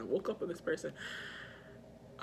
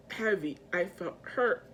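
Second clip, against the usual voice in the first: normal talking voice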